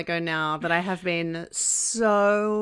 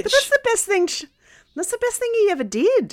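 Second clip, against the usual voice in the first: high-pitched, exclaiming disbelief; animated squeal of objection; indignant protest; 12 semitones higher, 3 dB louder than usual. Real, not acted. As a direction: protest it high, in playful outrage. Mock outraged protest, joining in.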